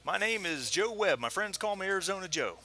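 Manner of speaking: Arizona Joe. Acted. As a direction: introduce yourself like a proud cowboy. Joe introduces himself.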